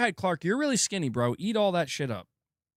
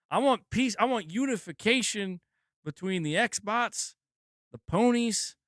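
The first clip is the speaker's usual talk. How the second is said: pitched-up, louder I want peace; louder plea; 6 semitones higher than usual. Real, not acted. Begging gamers for peace, louder and higher. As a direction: plead want loudly. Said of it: "rising plea".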